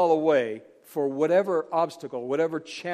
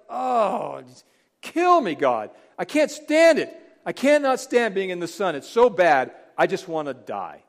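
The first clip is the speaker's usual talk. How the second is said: melodramatic whine, higher and louder; hammed-up plea to die; whining Jonah; 3 semitones higher, 5 dB louder than usual. Jonah scorched by the sun, begging God in a whine. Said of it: "He's like".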